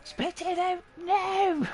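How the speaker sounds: very high, drawn-out mimicked squeal; squealing child. Daughter ordering dad to spit, imitated squeal. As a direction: imitate a little girl's slow shrieked order. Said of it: "she squealed the house down".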